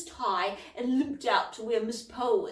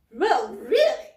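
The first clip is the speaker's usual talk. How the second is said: indignant; 5 semitones higher, 10 dB louder, slower than usual. Acted. Polwarth shocked by rude word.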